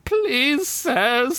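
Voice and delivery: put-on high, old elf begging; elderly elf pleads with the knights; elderly elf pleading. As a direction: beg like a frail old elf. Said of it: "said an elderly elf".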